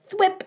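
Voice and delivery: high quick thwip sound; sound effect. Acted. Arm tossed aside sound.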